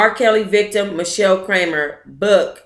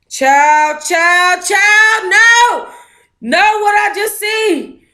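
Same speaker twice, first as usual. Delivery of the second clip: high, loud cry of disbelief; shocked outcry; 12 semitones higher, 4 dB louder, slower than usual. Real, not acted. Vlogger reacting to a shocking screenshot, high loud outcry. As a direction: cry out like you can't believe your eyes.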